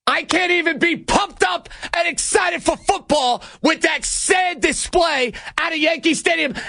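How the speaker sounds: high-pitched, exasperated shouting; indignant rant. Real, not acted. Host fed up with the Yankees' sad display, indignant rant. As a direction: rant at full pitch, stretching the big words in disbelief.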